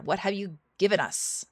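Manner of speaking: ordinary delivery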